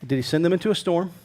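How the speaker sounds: usual speaking voice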